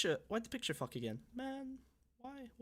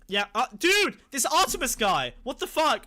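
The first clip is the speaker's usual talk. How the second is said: higher, louder outburst; outraged exclaim; 8 semitones higher, 8 dB louder than usual. Real, not acted. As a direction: blurt out in shock. Outraged by a donor's message.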